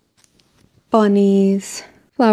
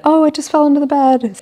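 higher, light feigned innocence; innocent mom cover; 8 semitones higher than usual. Retold or imitated. Mom covering for the tooth fairy, feigning innocence. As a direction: pretend it was there all along. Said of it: "throwing money on the floor".

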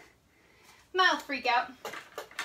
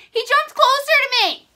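high, loud, horrified wail; real panic; 9 semitones higher, 8 dB louder than usual. Real, not acted. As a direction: wail it, terrified of the jumping bug. Cricket jumped toward her.

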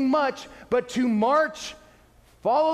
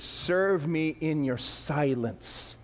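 slow, soft, lower words from God; quiet divine voice; 9 semitones lower, 5 dB quieter, much slower than usual. Retold or imitated. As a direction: say it gently, slowly, as God. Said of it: "He says".

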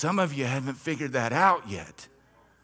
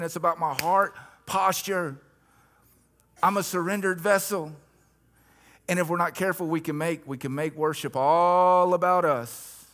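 higher, louder, driving preacher's cry; full-force preaching; 6 semitones higher, 6 dB louder than usual. Acted. Pastor urging the congregation, full-force preaching. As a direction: preach it out, rising and pushing hard.

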